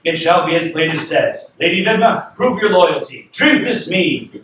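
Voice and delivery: acted royal command; commanding king. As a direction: issue a storybook king's challenge. Plays king giving a command.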